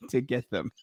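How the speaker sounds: ordinary delivery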